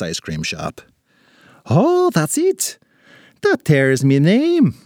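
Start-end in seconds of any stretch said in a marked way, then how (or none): 1.7-4.7 slow, high cry of recognition; excited leprechaun. Acted. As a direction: exclaim it as memory dawns. Leprechaun suddenly remembers his name.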